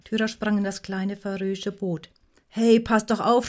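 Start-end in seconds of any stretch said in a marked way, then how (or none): 2.5-3.5 loud, higher, drawn-out warning; alarmed shout. A narrator's reading. Gyda clinging to the rocking boat, alarmed shout. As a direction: cry out in mild alarm. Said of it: "schrie Gyda".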